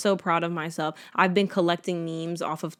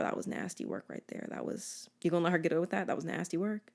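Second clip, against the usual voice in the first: hushed, half-whispered judgment; gossipy undertone; 10 dB quieter, faster than usual. Acted. To a girlfriend about someone being anti, hushed gossip.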